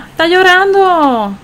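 high, loud and slow, stretched out in alarm; sudden dismay, drawn out; shocked exclamation. Real, not acted. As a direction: gasp it out as if you just caught someone crying. Sim suddenly seen crying.

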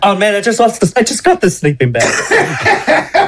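high-pitched mock whine, half laughing; comic high-pitched complaint; mock lament. Imagined reaction to waking naked.

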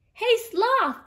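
loud high call. Acted. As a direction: call out to the sloth. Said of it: "they called".

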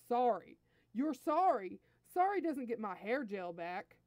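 loud, incredulous echo; outraged prince, punching each "sorry"; indignant retort. Acted. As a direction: throw the apology back angrily. Prince throws the apology back.

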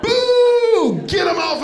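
loud booing shout from the crowd; heckler's boo. Retold or imitated. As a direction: yell it like an angry fan. Heckling fan booing comedian.

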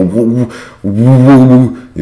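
high, drawn-out "woo" noises; playful high hooting; mock shocked wooing. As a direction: imitate a stunned crowd wooing. Imitates housemates' shocked reaction.

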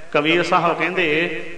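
ordinary talk, nothing marked

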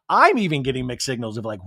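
ordinary delivery

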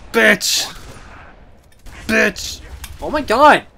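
loud excited yells; excited shout. Real, not acted. Gamer yells at a kill, excited shouting. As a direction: shout out in triumph.

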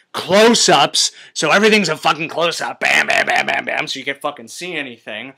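exasperated, punching each word; hammering out the bam bams; loud rant. Real, not acted. Ranting about camera cuts.

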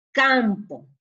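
CAMPO said loud and deliberately; reading out a word. Shown as a demonstration. Teacher reading the exercise word to pupils, loud and deliberate. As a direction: sound out "Campo" firmly for pupils.

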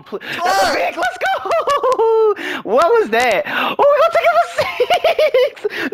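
very high, laughing celebration; ecstatic shrieking. Real, not acted. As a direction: squeal with laughter at a sudden turnover. Interception being run back in game.

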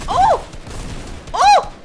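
high loud yelps; excited shout. Real, not acted. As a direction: yelp with thrill mid-fight. Excited in firefight.

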